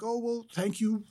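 sugary-polite doorstep farewell, lightly imitated; sweetest tone. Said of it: "in the sweetest tone of voice".

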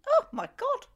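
high, loud gasp of surprise; amazed. Real, not acted. Mum stunned by her son's immaculate bedroom, amazed. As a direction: blurt it out, genuinely astonished.

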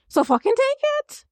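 high, sharp exasperated jab; exasperated outburst. Real, not acted. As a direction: throw it at them, fed up. Ranting at the couple.

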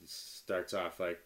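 usual speaking voice

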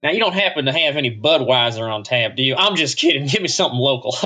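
put-on ridiculous Texas accent. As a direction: play up a silly Texas cowboy voice at the bar. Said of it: "increasingly ridiculous Texas accent like this".

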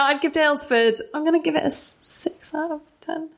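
six out of ten, fading to a whisper; through gritted teeth. Real, not acted. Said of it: "You're saying it through gritted teeth".

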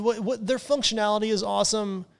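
ordinary delivery

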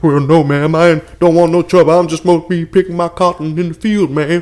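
Southern farmhand, protesting to the lady; hammed-up Deep South field worker; Deep South accent. Farmhand turns down boss's daughter, Deep South accent. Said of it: "I love that accent".

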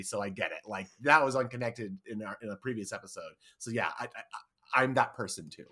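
ordinary talk

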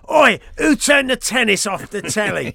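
hammed-up cockney landlord yelling, slowing down at the end; cockney pub landlord. Host mocks the crackly line as a cockney landlord shouting about the telly. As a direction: play a cockney pub governor throwing people out. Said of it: "Cockney Dalek, like the governor of the Queen Vic".

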